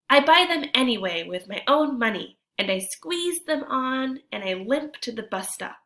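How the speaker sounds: storytime reading voice, a touch lively; story narration. A narrator's reading. Teacher reads the picture book to kids, lively narration. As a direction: read the tale to the class.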